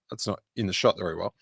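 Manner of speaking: ordinary delivery, nothing marked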